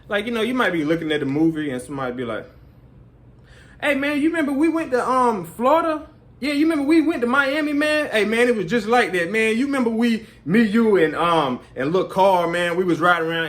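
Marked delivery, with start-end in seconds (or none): 3.8-13.5 high, eager mock chatterbox; pitched-up imitation of a talker; chatty moviegoer. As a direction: play the friend who won't stop reminiscing.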